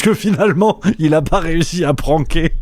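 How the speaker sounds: amused, laugh bubbling up mid-question; laughing through it. Real, not acted. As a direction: barely hold back a real laugh. Laughing at his own prank theory.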